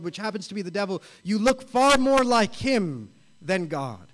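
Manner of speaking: stern pulpit fervour; preaching. Acted. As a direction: urge the congregation with conviction. Preaching warning about sin.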